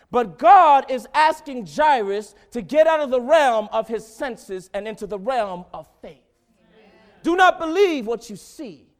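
loud, high preaching build; full-force preaching. Real, not acted. Preacher rousing the congregation, loud and high. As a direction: preach it out to the church.

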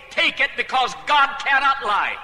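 loud, high, slow proclamation; commanding. Acted. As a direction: proclaim it as God's own command. God's command against the devil's saying.

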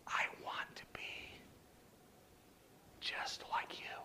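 voiceless, very soft and deliberate; whisper. Retold or imitated. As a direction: whisper it like a confession. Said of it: "I would like him to whisper to me".

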